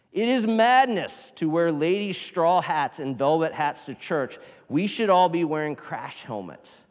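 loud, fervent reading of the quote; dramatic reading. Shown as a demonstration. Preacher reading Annie Dillard to the church, fervent and loud, ending in a whisper. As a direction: read it like a warning to the church. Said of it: "She says".

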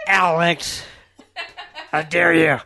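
high, loud mock-outraged cry, cracking with laughter; crackly voice. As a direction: scold in pretend outrage, voice crackling. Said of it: "That's a crackly voice".

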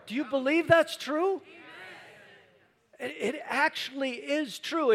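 loud, high preaching; full-force preaching. Acted. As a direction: preach it hard to the crowd.

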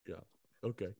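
normal talking voice

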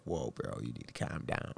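low, hushed aside; soft, low-pitched settling tone; calming self-talk. Retold or imitated. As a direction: talk yourself down quietly. Quotes telling himself to calm down.

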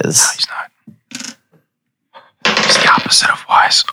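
hushed unvoiced aside; whisper. Real, not acted. DM whispers an aside after the roll. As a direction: whisper it under the table talk. DM whispers about dumb orc.

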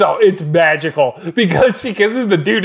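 usual speaking voice

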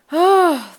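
loud, high sigh of relief; big relieved sigh. Retold or imitated. Owner finds the door open, loud relieved sigh. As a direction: let out a heavy, acted sigh.

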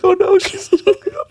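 very high squeaky retold worry; mock dismay. Husband retells watching the livestream, high-pitched mock dismay. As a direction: squeal it as an anxious onlooker. I was like.

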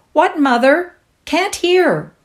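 high loud call up the stairs; calling upstairs. Acted. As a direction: shout up to mother.

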